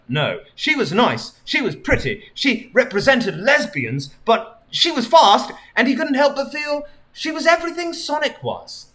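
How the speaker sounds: usual speaking voice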